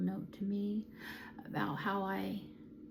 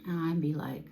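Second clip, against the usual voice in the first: normal talking voice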